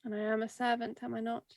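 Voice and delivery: hushed, private question; soft in-character murmur. Acted. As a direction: murmur it quietly, half to yourself. Character softly addresses the raven.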